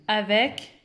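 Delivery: normal talking voice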